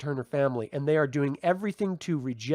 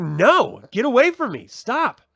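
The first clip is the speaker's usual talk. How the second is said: higher, louder "get away from me" yell; retold yell; 6 semitones higher, 8 dB louder, slower than usual. Leanne shouting at the creepy old man, loud and high. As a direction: push him off with a sharp loud refusal. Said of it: "Leanne is just like".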